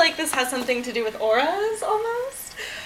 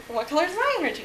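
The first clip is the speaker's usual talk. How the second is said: higher, lightly voiced friend; imitating friend's question; 6 semitones higher, much faster than usual. Friend asking the speaker her energy color, lightly imitated. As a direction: quote a friend asking back, pitched up. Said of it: "She's like".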